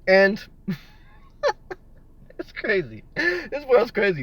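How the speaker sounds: slow, laughing it's crazy. Real, not acted. Wrapping up the stream, slow and laughing. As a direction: chuckle and drag it out.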